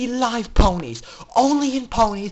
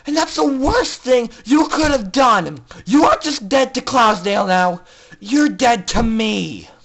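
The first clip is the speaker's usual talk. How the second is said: loud, higher, cold furious shout; renewed fury; 5 semitones higher, 7 dB louder than usual. Acted. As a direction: condemn her with every word. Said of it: "remembering her anger suddenly".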